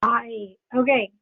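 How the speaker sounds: ordinary talk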